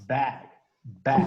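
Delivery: Bag con la B bien fuerte; strong English b. Shown as a demonstration. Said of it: "la B larga en inglés es bien fuerte". (bag con B fuerte)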